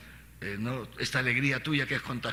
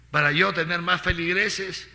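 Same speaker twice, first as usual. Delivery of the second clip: mock question; 6 semitones higher, 6 dB louder than usual. Mocking a misguided motive.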